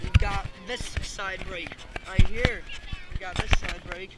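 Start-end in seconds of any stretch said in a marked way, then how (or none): 0.0-2.6 slow, loud drawn-out explaining. Shown as a demonstration. Showing the viewer the tractor's side brake, slow and loud. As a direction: spell it out slowly like a guide.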